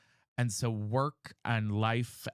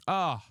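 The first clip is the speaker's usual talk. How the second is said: frustrated groan; 4 semitones higher, 5 dB louder than usual. Real, not acted.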